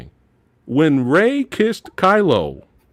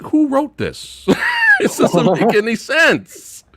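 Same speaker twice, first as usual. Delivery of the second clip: normal talking voice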